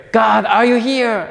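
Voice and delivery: high, loud, pleading call upward; desperate cry to God. Retold or imitated. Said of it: "he cries out like".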